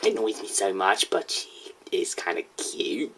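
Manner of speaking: hushed embarrassed whisper. Real, not acted. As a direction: whisper it so nobody hears. Confessing about a girl.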